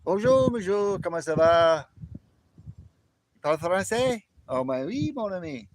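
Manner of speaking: French accent, pitched high and loud; accento francese. Shown as a demonstration. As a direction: show off your French with a high, ringing greeting. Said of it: "I got the pronunciation and the accent down exact".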